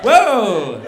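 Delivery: loud, high mock-outraged whoa; hammed-up cry of disbelief; shocked protest. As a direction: act out a stunned yell. Acting his shock at the no.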